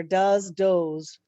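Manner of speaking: "does" first as duz, then as dohz; "does" said duz then dohz, spelling the same; two pronunciations of does. Shown as a demonstration. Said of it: "the pronunciation would be different".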